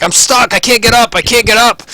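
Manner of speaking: high, loud cries of a trapped victim; frantic high-pitched shouting; panicked yelling. Acted. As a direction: yell for help, stuck fast. Ront stuck in spider web.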